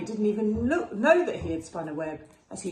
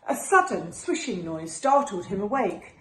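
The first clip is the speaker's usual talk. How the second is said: usual speaking voice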